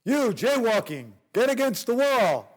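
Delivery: mock cop shouting an order; cop yelling. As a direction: bark it like an angry cop. Cops yelling at them.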